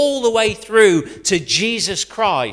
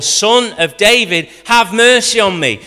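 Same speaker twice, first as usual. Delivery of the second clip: loud, high, desperate plea; shouting louder; 5 semitones higher, 7 dB louder than usual. A narrator's reading. Beggar shouting over the crowd hushing him. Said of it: "he shouted all the more".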